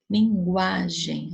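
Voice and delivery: "Linguagem" drawn out, leaning on gua; slow lin-GUA-gem, stressed middle syllable. Shown as a demonstration. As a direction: stretch the strong A in "Linguagem". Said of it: "o A é mais forte".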